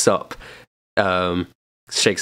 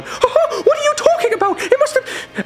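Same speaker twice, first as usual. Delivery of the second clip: much higher, laughing indignant yell; outraged retort. Acted. A guest arguing back at Joy, outraged. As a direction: fire back in disbelief.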